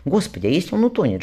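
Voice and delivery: plain reading voice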